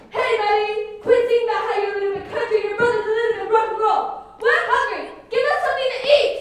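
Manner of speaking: loud, high yelling of a mob; angry crowd shout. Acted. The hungry French crowd yelling at the palace door.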